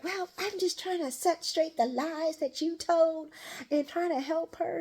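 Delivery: high-pitched protesting excuse; mock defensive pleading. Woman justifying herself to angry father, high mock pleading. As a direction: imitate someone pleading their case, pitched well up.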